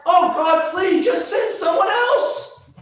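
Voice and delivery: high, loud, slow plea; begging Moses. Acted. Moses begging God to send someone else, high and loud.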